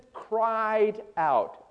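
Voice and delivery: "cried out" drawn out slowly, each word weighted; stress on cried out. Shown as a demonstration. Preacher underlining a word for the congregation, "cried out" stretched slowly. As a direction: stretch "cried out" long and emphatic. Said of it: "Underline that word, cried out".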